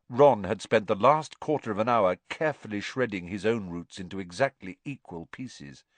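usual speaking voice

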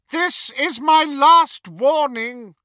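slow, loud, menacing order; cold command. Acted. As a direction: command her out of the way. Dark wizard threatens mother.

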